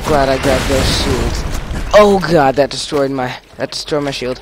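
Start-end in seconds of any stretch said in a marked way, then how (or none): 1.9-2.6 startled cry. Real, not acted. Shield destroyed, sudden scare.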